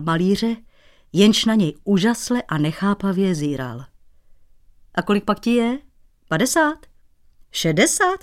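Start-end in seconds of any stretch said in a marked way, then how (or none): 4.9-8.2 rising higher and higher; prying. Acted. Cobbler pokes the painter about his age, teasing rising squeak. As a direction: needle him with each guess. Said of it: "vyzvídal Dratvička".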